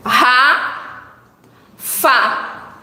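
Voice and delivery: Ra and fa as separate slow syllables; sounding out the end of garrafa. Shown as a demonstration. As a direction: split Ra, fa with pauses. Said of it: "Nesta palavra nós temos".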